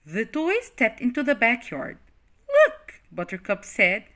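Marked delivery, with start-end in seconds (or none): 2.6-3.0 very high loud exclamation; high thrilled gasp of a word; excited look. Acted. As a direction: point up in delight. Buttercup spots stars.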